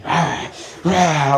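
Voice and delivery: imitated wild-animal snarl; mock attacking growl. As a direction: growl like a pouncing hyena. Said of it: "she starts attacking me".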